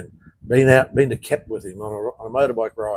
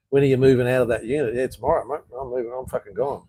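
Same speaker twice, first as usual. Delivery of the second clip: ordinary talk, nothing marked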